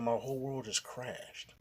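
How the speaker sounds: slow and soft, heartbroken; devastated. Retold or imitated. Telling how the news hit, devastated and slow. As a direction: let the words fall apart. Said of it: "I crashed. I broke down".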